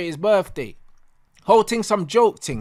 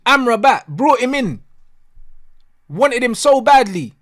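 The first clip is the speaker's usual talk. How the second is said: loud, high exasperation; fed-up fan venting; indignant rant; 4 semitones higher, 6 dB louder than usual. Real, not acted. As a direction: punch out each grievance with raised voice. Angry rant about unused signing.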